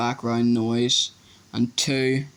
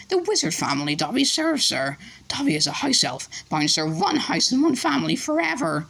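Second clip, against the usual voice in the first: much higher elf voice; house elf voice; 9 semitones higher than usual. A narrator's reading. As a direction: voce acuta da elfo. Elf explaining.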